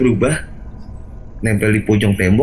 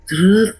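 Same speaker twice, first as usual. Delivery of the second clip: short high-pitched "sret"; imitated dragging sound; 12 semitones higher than usual. Telling how the cloth got pulled, imitated dragging sound. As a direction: voice the tug as a high swish.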